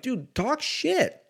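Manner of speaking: high, slow goofy voice; slow, high playful taunt; mock urging. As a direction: drag it out, egging them on. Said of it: "I'm like".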